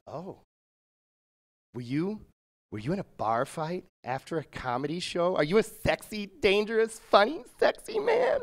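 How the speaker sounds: breathless fan building to a squeal; flirty excitement. Retold or imitated. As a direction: swoon over him. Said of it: "She goes".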